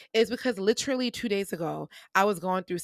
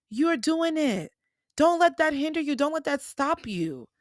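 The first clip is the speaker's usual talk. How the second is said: pep talk; 6 semitones higher than usual. Retold or imitated. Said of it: "because I'm like".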